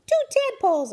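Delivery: high, loud hamster squeak; excited squeak. A narrator's reading. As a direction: squeal it out with delight. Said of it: "I squeaked".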